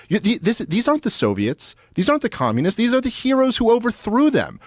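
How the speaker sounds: a hint of a baffled old diplomat pleading; exasperated Kennan quote. Kennan arguing these aren't the Soviets, mock pleading. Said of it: "And he's like".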